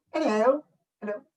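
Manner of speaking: high, dainty mock-British greeting; pinky-out British. Shown as a demonstration. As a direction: say it like a prim British tea drinker. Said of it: "put your pinky finger out like this".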